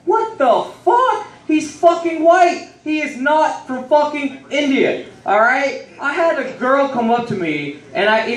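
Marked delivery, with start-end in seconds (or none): 0.1-5.9 high, drawn-out comic indignation; incredulous outrage. Retold or imitated. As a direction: protest in hammed-up disbelief. Outraged at friend passing as Indian.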